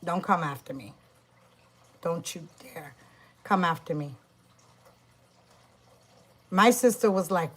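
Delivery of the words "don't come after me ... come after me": low, soft menacing warning; quiet threat. Real, not acted. Witch warning the woman who bashed her, low and menacing. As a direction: say it like a quiet curse.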